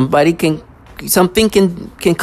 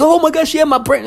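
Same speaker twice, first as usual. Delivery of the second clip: mock exclamation; 12 semitones higher than usual. Mimics others' exclamation about their brain.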